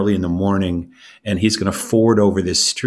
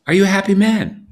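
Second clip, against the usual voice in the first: eager question; 11 semitones higher, 4 dB louder than usual. Acted. King presses the beggar.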